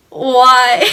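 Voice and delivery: loud, mock-shocked outburst; shocked question. Mom hearing about the police ride, mock shocked.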